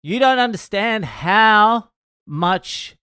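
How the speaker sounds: high, loud, slow mock rant; retold yelling. Drunk girl yelling at comedian about his joke, mock yelling. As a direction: imitate a drunk girl's outraged yelling. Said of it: "is yelling at him".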